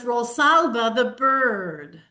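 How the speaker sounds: slow storybook read-aloud; reading to children. Acted. Showing a page of the picture book, slow read-aloud. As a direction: read the caption slowly, like to a child.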